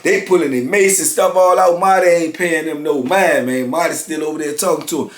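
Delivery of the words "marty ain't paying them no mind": ordinary delivery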